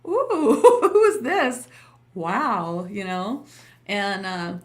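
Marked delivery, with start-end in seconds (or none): 0.1-2.9 awestruck. Retold or imitated. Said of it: "and I was just like".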